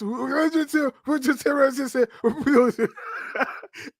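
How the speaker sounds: high laugh after the impression. Real, not acted. Guest cracks up after mimicking wrestler, laughing. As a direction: break up laughing at your own mimicry.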